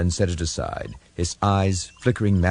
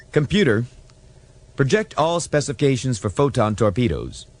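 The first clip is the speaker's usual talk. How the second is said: eager ensign; 7 semitones higher than usual. Acted. Ensign addressing the computer.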